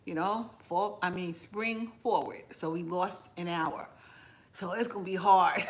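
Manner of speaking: usual speaking voice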